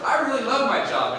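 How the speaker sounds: high and loud, beaming through a smile; big forced smile. Retold or imitated. Telling the principal he loves the job, beaming smile. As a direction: sell it with the biggest grin you can. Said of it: "with the biggest smile I could muster".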